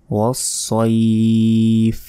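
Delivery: ওয়াসসাইফ as "was-soeeef", heavy s, long ee; slow full-mouthed ওয়াসসাইফ; correct pronunciation. Shown as a demonstration. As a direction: hold the ee before f in ওয়াসসাইফ. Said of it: "ওয়াসসাইফ নয়".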